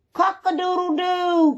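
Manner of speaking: high, far rooster cock-a-doodle-doo; rooster crow. A narrator's reading. Narrator crowing as Rooster to wake the farm. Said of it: "crowed Rooster".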